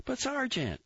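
high pleading cry; Grossbart pleading. A narrator's reading. Recruit begging his sergeant for backup, high plea. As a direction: plead up high, hurt and surprised.

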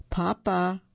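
plain reading voice

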